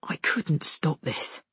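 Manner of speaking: slow, low man's voice; hushed, heavy apology; regretful. A narrator's reading. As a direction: confess it slowly and quietly. Soldier apologizes to girl.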